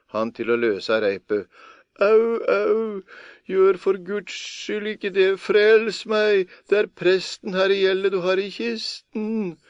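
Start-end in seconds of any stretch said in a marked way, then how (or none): 2.0-9.6 high, loud terrified cries; priest screaming from chest. A narrator's reading. Priest trapped in chest begs Per, terrified screaming. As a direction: scream for your life from inside the chest. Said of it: "skrek han som i den var".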